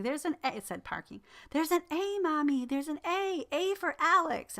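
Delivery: mock the little boy shouting his letter; excited child. Little son on the parking lot floor, excitedly squealing about letters. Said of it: "was screaming".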